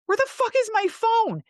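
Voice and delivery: high, loud frantic demand; retold panic. Woman searching for the phone he took, loud retold panic. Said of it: "I'm, like, panicking".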